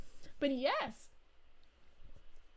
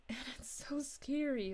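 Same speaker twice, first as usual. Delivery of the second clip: hushed scared whisper; 7 dB quieter than usual. Real, not acted. Streamer recalling angry Bowser's fire, whispered. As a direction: drop to a breathy whisper on scary.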